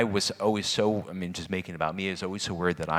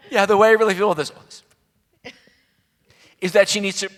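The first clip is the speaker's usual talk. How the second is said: put-on much higher, louder, fast teen gamer chatter; imitating a teen boy; 13 semitones higher, 13 dB louder, faster than usual. Mother mimicking her son on his Xbox podcast, hammed-up teen voice. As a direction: ham up a teenage boy holding forth, fast and high.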